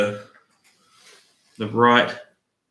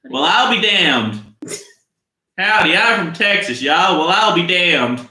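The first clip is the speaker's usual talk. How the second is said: put-on terrible Southern accent; 7 semitones higher, 6 dB louder than usual. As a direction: belt it out in a badly faked Texas accent. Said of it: "too aggressively Australian to do any accents".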